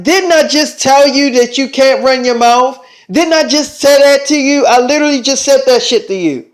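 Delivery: indignant, worked-up rebuke; acted scolding. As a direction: scold them for not listening. Scolds team for not listening.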